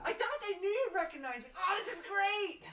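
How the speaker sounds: high, loud overjoyed gush; excited. Acted. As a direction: gush with delight, slowing at the end. NPC overjoyed at reunion.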